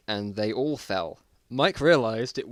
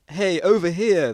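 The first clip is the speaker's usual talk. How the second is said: calling out; 8 semitones higher, 9 dB louder than usual. Acted. Survivor calling out.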